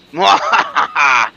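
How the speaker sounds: loud gloating cackle; evil villain laugh. Acted. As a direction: cackle over the beaten heroes. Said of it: "as he gloats".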